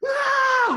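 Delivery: full-out, high, loud "Ah!"; battle scream. Retold or imitated. Running on stage with a weapon for the rumble, screaming. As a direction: scream as if charging in to scare them.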